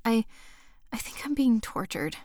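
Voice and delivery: whispered, barely voiced; a shaken teacher confiding to her partner; choked whisper. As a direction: breathe it out, voice failing. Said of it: "but my voice choked".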